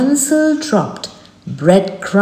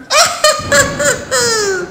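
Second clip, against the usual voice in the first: high evil cackle; witch cackle. A narrator's reading. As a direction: cackle like a hag. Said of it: "said the witch".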